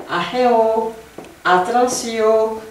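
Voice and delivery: AHEO and ATTENTION climbing, then held high; rising tone. Shown as a demonstration. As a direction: raise the pitch through AHEO and ATTENTION, stretching the endings. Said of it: "quelque chose qui va en montant".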